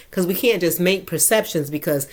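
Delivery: normal talking voice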